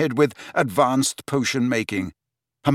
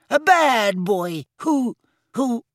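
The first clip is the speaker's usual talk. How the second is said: much higher, slow, stammering squeak; faltering squeaky elf; 12 semitones higher, slower than usual. Acted. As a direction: squeak and falter as he fights himself. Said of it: "He shuddered".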